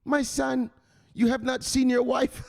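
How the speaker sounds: high pleading wife voice, laughing; mock wife's plea. Streamer mimics Michelle's wife begging him upstairs, high and pleading.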